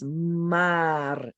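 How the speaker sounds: MAR with a long, trilled final r; rolled R. Shown as a demonstration. Teacher shows Spanish vowel R, rolled R. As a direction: say "Mar" as two sounds, ah then rolled r. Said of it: "we would roll those R's".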